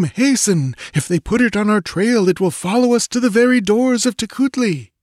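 high, fearful voice of the guide; frightened Tekodl. A narrator's reading. Tekodl warns Conan about the Crawler, high and afraid. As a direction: pitch it high and hurried with dread.

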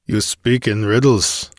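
ordinary delivery, nothing marked

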